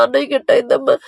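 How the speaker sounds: a soft, tearful imitation of the aunt; imitating the aunt crying. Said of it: "ఏడుస్తా ఉండేది".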